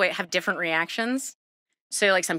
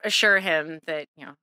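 ordinary talk, nothing marked

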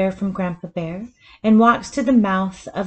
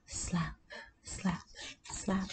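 soft slaps; 15 dB quieter, slower than usual. A narrator's reading. Riffles slap the boat.

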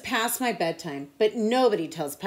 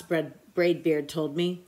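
ordinary delivery, nothing marked

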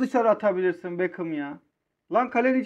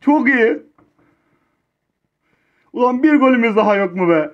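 much louder full-throated caster roar; triumphant shout; 8 dB louder than usual. Real, not acted. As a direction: roar the comeback. Comeback shouting at climax.

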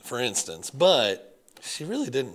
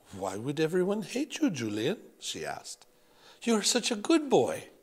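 a doting French grandmother, higher; French accent; 5 semitones higher than usual. Acted. Grandmother comforting grandson, French accent. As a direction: reassure him in a French accent. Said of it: "Grandmere had a thick French accent".

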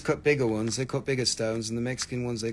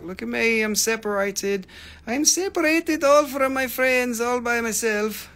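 talking stone; 7 semitones higher than usual. Pretend. Seller speaking as the separated stone, high playful voice. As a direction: sing out high, as the lonely stone.

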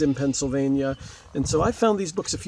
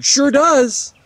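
neighbor answering; 9 semitones higher, 8 dB louder than usual. Acted. Jackie answering from yard.

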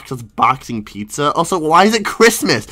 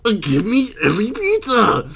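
customer voice; 7 semitones higher than usual. Acted. Customer role ordering.

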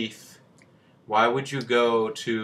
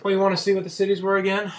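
high playful voice; 10 semitones higher, 5 dB louder, faster than usual. Pretend.

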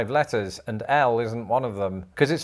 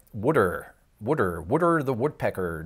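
Philly accent, water said as "wooder"; Philadelphia wooder. Shown as a demonstration. Imitating how Philadelphians say water, Philly accent. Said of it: "pronounce it something like wooder".

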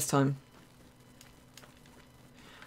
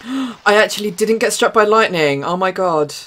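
excited; 5 semitones higher, 6 dB louder than usual. Real, not acted. Surprised she survived lightning.

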